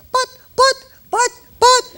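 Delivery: very high, loud sputtering; mock flustered protest. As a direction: imitate a fretful coworker squeaking objections. Mimics day nurse protesting.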